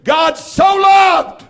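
high, loud, drawn-out cry; ringing sermon shout; preacher's shout. Real, not acted. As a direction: cry it out to the rafters.

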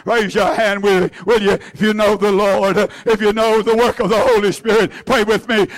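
ordinary talk, nothing marked

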